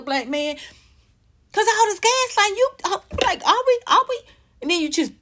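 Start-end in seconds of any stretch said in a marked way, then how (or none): 1.5-5.1 high heated rant. Real, not acted.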